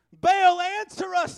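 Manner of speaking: a loud desperate cry to Baal; frantic worshippers crying out; shouted. A narrator's reading. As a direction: call out to the sun god at the top of your voice. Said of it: "they shouted".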